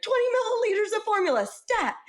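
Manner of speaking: very high, fast, commanding; urgent order. Retold or imitated. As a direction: call it out like a doctor. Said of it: "I was like".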